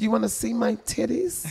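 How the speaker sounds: high, slow mock-seductive voice; put-on phone-chat voice.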